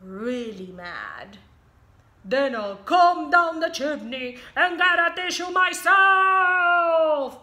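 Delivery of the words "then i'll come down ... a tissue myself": loud, high furious roar. Acted. Angry wolf threatening the pigs. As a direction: roar it in a rage. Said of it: "he roared".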